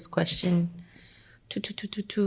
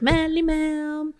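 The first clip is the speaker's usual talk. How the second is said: cartoon princess; 9 semitones higher, 3 dB quieter, much slower than usual. Host announcing her name.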